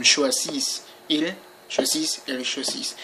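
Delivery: "choisis" and "choisit" both ending in an audible S; sounded final S. Shown as a demonstration. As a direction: say "choisis" as shwa-zeess, like "choisissent". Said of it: "you have to pronounce one of them".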